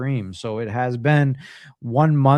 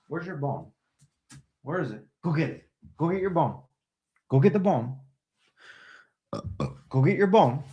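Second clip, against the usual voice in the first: higher, eager voice for the dog; coaxing dog voice; 4 semitones higher, 4 dB quieter than usual. Real, not acted. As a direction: cheer the dog on to fetch. Urging dog to fetch.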